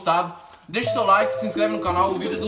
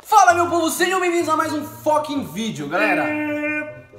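excited intro shout; 6 semitones higher than usual. Real, not acted. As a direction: call out to the viewers. Vlog intro greeting.

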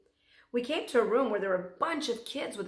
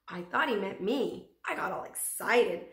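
ordinary delivery

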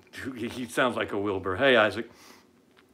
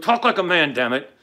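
louder exasperated order; forceful demand; 3 semitones higher, 6 dB louder than usual. Real, not acted. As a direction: lay down the law. Exhorting men to talk manly.

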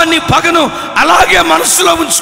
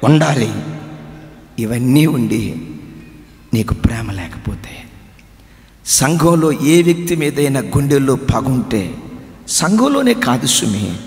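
hushed earnest preaching; 12 semitones lower than usual. Acted. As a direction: lean in and warn gently. (మెల్లగా, గంభీరంగా హెచ్చరిక)

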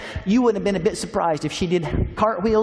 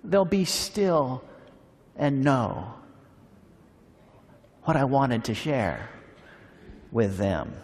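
drops lower and softer, trailing away; fading wistful resignation; 4 semitones lower, 5 dB quieter, slower than usual. Retold or imitated. God left unheard, trailing off softer and lower. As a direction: let the hope slowly give up. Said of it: "God is saying".

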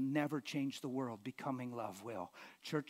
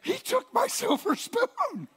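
laughing mock outrage; 11 dB louder, slower than usual. Wife's outrage told laughing.

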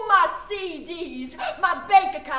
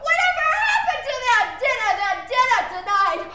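higher, louder mock mother yell, quickening; mother's scream; 8 semitones higher, 5 dB louder than usual. Mama coming home yelling about dinner, mock scream. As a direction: screech it like an angry mom. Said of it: "screams at the top of her lungs".

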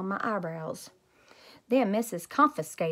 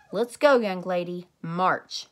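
firm, clipped command; march her off, no arguing; stern order; 6 dB louder, slower than usual. Acted. Teacher ordering Junie B to the office, stern.

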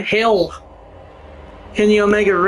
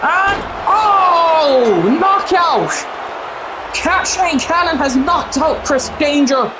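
excited shout; 7 semitones higher, much slower than usual. Real, not acted. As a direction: scream the finish. Knockout finish called.

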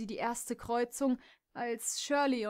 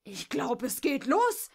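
loud, rising, cutting snap; sharp retort; 5 semitones higher, 6 dB louder than usual. Acted. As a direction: fire it straight back. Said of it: "pfefferte Jessie ihr entgegen". (Jess schleudert Kimberly scharf und laut entgegen)

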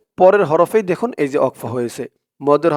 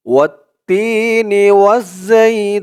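slow melodic tajweed chant, a bit higher; Quran recitation; 4 semitones higher, 3 dB louder, much slower than usual. Shown as a demonstration. Teacher reciting for viewers to repeat, slow tajweed chant. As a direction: recite it slowly so students can follow. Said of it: "আমার সাথে বলুন".

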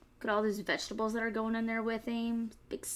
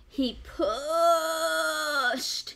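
very slow, higher, straining words; slow effortful narration; 8 semitones higher, 6 dB louder, much slower than usual. A narrator's reading. Narrator shows the fox's hard work, very slow. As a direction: drag out each verb like hard work.

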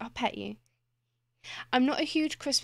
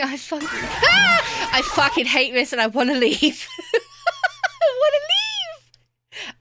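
words squeezed out through laughter, climbing much higher; laughing hard; 6 dB louder, slower than usual. Real, not acted. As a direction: laugh helplessly through every word. Laughing at a Scream knife swipe.

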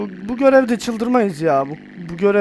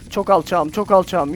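normal talking voice

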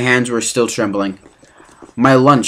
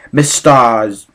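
plain reading voice

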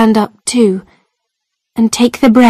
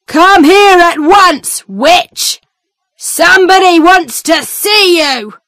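guard shouting; 8 semitones higher than usual. Acted. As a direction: order her out like a harsh jailer. Guard summons prisoner.

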